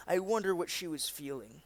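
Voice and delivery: normal talking voice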